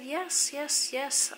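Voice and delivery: high-pitched re-enacted insisting; friendly insistence. Retold insisting on giving money.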